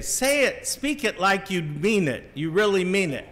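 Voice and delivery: high-pitched parental urging; dad's exasperated coaching, acted out; imitated insistent dad. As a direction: press the child to really mean it. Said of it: "And then he would say".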